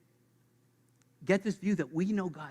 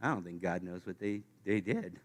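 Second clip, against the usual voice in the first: grumbling doubt; 9 semitones lower, 7 dB quieter than usual. Pretend. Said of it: "Second one is".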